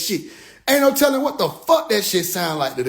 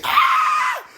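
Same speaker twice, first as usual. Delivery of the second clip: high shouted yelp; shocked yelp. Retold or imitated. Stunned by the alien knowing, high yelp. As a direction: let out a sharp high cry.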